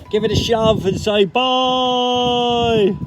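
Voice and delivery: high, slow shout. Real, not acted. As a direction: yell it out as the car goes. Pushing the car off the ramp.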